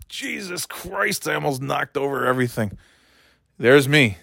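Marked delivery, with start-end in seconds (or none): 0.1-2.8 strained mutter. Real, not acted. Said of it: "You'll hear what it's like when I sit down".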